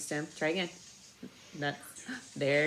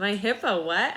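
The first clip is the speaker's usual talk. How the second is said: surprised; 3 semitones higher, 5 dB louder than usual. A narrator's reading. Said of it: "asked Rosie in surprise".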